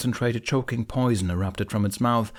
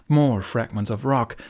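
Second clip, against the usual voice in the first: ordinary delivery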